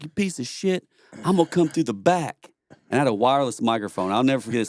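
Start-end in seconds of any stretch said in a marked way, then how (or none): 0.0-2.5 higher, mock yelled threat; retold threat. Thrown-out guy threatening the singer, mock yelling. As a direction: bark it like the angry guy being thrown out. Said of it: "he was like".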